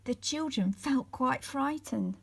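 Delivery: ordinary delivery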